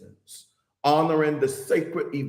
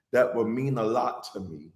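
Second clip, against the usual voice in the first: ordinary delivery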